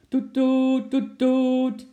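high, loud honking toet-toet; car horn imitation. Monkey slams the horn, high loud horn toots. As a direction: honk like an impatient car horn. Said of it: "sloeg hij nijdig op de toeter".

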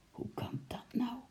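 soft whispered disbelief. A narrator's reading. Uncle repeating his question, stunned whisper. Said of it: "zei oom Nijltje weer".

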